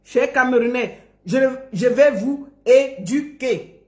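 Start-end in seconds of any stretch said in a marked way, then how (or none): 1.2-3.8 slow "éduquer" in separate syllables; careful, spaced-out "é-du-quer" for "éduquer"; correct pronunciation. Shown as a demonstration. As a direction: model the rounded "u" of "éduquer". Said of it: "Apprends d'abord à dire éduquer".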